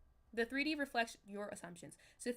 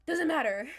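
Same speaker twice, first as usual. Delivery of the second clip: much louder, flung out; emphatic; 5 semitones higher, 12 dB louder than usual. Real, not acted. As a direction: shout it away. Insists it does not matter.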